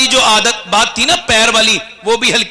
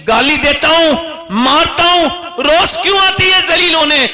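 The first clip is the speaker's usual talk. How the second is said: building angry shout; 8 semitones higher than usual. Acted. Chaudhry berates the pious woman.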